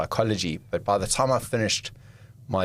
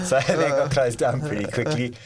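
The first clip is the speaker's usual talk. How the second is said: laughing; 4 semitones higher than usual. Real, not acted. Still laughing about nightclub.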